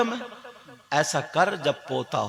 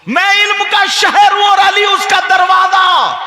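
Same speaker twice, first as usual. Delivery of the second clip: loud, high proclaiming sermon voice; fervent preaching; 13 dB louder than usual. Acted. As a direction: proclaim the hadith to the crowd. Translates hadith at full force.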